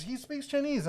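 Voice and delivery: put-on high old lady voice, matter-of-fact; a lightly voiced grandma, pleased and firm; old woman stating. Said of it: "And she goes".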